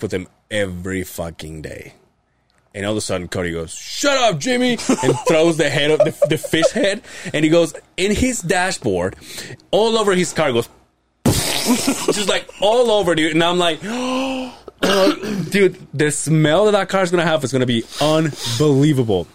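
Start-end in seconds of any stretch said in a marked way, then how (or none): 3.7-4.7 high-pitched mock outburst; mock-exasperated shut-down; retold irritated yell. Retold snap at a coworker. 13.8-15.4 big high gasp of horror; sharp intake of breath, acted shock. Acting out his shocked reaction.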